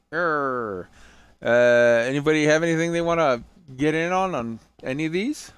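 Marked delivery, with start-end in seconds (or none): 0.1-0.9 pirate growl. Pretend. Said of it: "Talk Like a Pirate Day".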